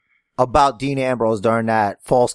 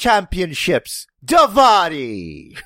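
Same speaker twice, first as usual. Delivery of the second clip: slow, higher, drawn out announcement; mock prince announcer; 6 semitones higher, 3 dB louder, slower than usual. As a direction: proclaim it like a rich prince. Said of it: "character I made for the Saudi Arabian prince".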